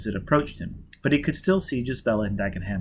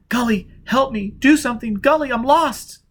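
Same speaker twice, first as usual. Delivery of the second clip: pleading; 11 semitones higher, 5 dB louder than usual. Acted. Jiz begging Gully to save her, desperate. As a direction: beg him for help.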